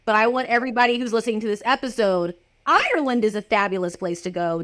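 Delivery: usual speaking voice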